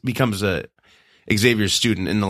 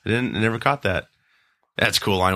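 ordinary talk, nothing marked

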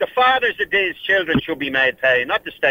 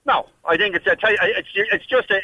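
usual speaking voice

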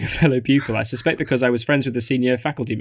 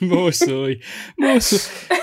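mock English "merci", rising higher; merci said like more soy; foreign accent in french; 6 semitones higher, much slower than usual. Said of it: "sounding ridiculous".

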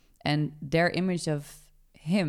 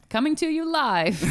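announcer voice; 10 semitones higher, 4 dB louder than usual. Pretend. Mock news broadcast opener.

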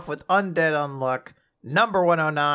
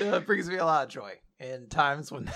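ordinary talk, nothing marked

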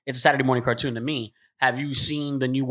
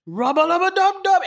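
cartoon catchphrase; 5 dB louder than usual. Rick and Morty catchphrase shouted.

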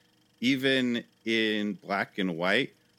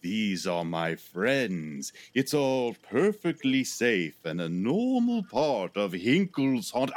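slow, soothing showman reassurance; calm the audience like a host; slower than usual. Acted. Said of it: "And he says".

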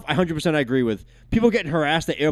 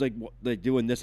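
ordinary talk, nothing marked

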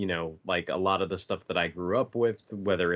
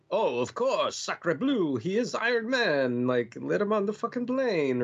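high, hammed-up French accent; mock French airport official, sacre bleu; 9 semitones higher than usual. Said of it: "one of the men officials comes up and is like".

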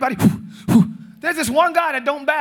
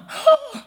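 a sharp high gasp; hammed-up gasp at the news; mock shocked gasp; 5 dB louder than usual. Reaction to the death decree.

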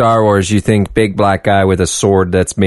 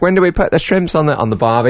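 lightly hammed Australian accent, higher at the start; mock Australian; 8 semitones higher than usual. Tourists mimicking Australians, lightly mocking Australian accent. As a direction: play the cliché Australian. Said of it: "It's like".

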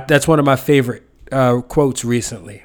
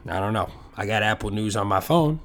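usual speaking voice

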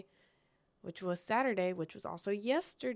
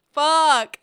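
loud, high exasperated curse; frustrated curse; 9 semitones higher, 16 dB louder than usual. Retold or imitated. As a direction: spit it out in disbelief. Missed the knockout on stream.

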